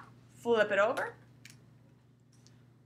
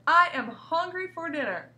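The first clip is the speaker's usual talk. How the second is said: higher and louder exclamation; exclaiming; 6 semitones higher, 4 dB louder than usual. Shown as a demonstration. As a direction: announce your hunger with force. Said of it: "that's always an exclamation point".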